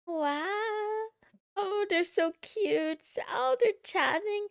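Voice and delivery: high, loud cooing delight; gushing. Real, not acted. Watching the couple chat, high loud gushing. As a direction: melt over the cute couple.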